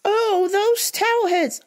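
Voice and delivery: high, slow, sneering prissy voice; imitating the lady's sneer a day later, high and slow. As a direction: drag out the slur in a snooty tone. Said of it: "Twenty-four hours later".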